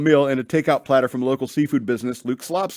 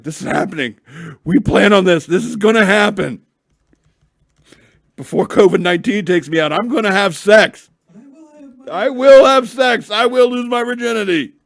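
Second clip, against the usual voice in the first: overexcited declaration; 8 semitones higher, 9 dB louder than usual. Retold or imitated. Imagined couple's eager vow.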